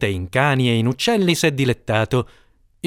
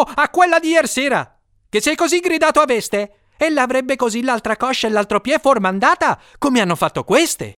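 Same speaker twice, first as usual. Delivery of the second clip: much higher, flustered servant voice; frightened cook finding a clever excuse, much higher; dismayed servant; 12 semitones higher than usual. A narrator's reading. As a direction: answer in a rush, almost bewildered. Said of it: "quasi sbigottito".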